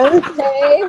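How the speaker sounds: laughing through the word. Retold or imitated. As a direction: giggle it out. Jessica's reply, laughing.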